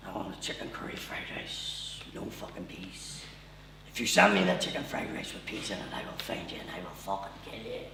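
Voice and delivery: very low, hushed menacing movie-threat voice; Liam Neeson. Acted. Drunk caller phoning the Chinese at half two, low menacing Taken voice. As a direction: growl it low and softly like an action hero. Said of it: "the voice you do at half two".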